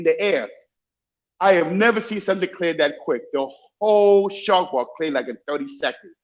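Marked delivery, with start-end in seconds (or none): none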